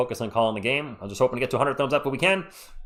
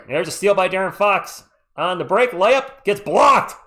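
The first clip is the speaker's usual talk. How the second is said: rising, louder as the break unfolds; excited play call; 6 semitones higher, 6 dB louder, slower than usual. Real, not acted. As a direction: call the fast break, then the block. Fast break call, then blocked.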